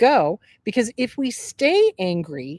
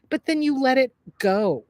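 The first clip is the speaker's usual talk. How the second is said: ordinary delivery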